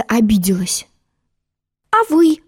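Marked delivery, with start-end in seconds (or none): 1.9-2.4 put-on high, indignant girlish question; young girl voice. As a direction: ask back like a hurt girl. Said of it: "спросила она".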